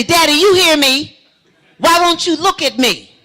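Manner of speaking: loud, high, angry demand; mad. Retold or imitated. Daughter angry her father ignores her, loud high demand. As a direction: demand he finally look at you. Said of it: "And then I get mad".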